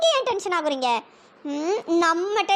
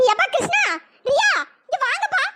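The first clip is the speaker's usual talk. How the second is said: calling out; 7 semitones higher than usual. Acted. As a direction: call them from another room. Calling son from inside the house.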